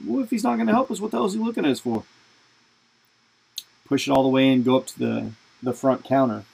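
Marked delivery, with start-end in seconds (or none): 0.1-2.0 high, fast mock dad; squeaky mocking impression of a pushy dad. Dad brushing off the old man.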